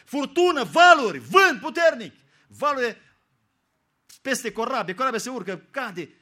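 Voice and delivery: loud, high shout of the storm. Acted. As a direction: paint the storm with your full voice. Preacher paints the storm. (strigăt dramatic despre furtună)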